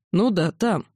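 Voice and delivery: plain reading voice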